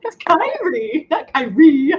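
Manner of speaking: high, slow, laughing correction of "Kyrie"; correcting pronunciation. Retold or imitated. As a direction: set "Kyrie" against "not Kyrie" slowly, laughing. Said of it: "And I'm just like".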